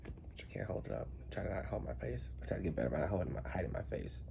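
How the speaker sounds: soft real whisper, mostly breath; whispering a quick aside. Real, not acted. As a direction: drop to a private murmur to yourself. Whispered aside while adjusting camera.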